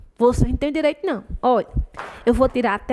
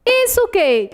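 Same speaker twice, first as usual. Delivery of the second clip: much higher, louder quizzing "Isso o quê?"; mock question; 10 semitones higher, 3 dB louder than usual. Shown as a demonstration. Teacher acting out the question students should ask, high and quizzing.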